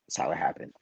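unvoiced whispered aside. Real, not acted. Telling the host how it happened, whispered. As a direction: drop to a secret whisper. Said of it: "whisper aside".

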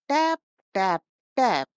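loud knocking noises; bright loud knock knock knock; knocking sound. A narrator's reading. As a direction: rap on the door three times. Girl knocks at the door.

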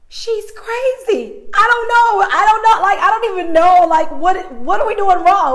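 high, loud, disbelieving ranting; wife telling husband, loud and frantic; exasperated outburst. Retold or imitated. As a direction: vent at the husband in shock. Upset telling husband in car.

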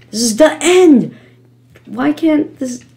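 high, frustrated protest; real exasperation at a game; exasperated. Real, not acted. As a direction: complain loudly, pitch jumping up. Frustrated at failing near the end.

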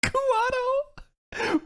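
high-pitched incredulous question; incredulous echo. Real, not acted. Viewer echoing a character's odd nickname, incredulous. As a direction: squeak the name back in disbelief.

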